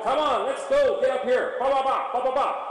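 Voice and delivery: an energetic come on, let's go with ba ba ba; high-energy cue. Shown as a demonstration. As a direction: fire the horse up with your voice. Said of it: "No, you gotta go".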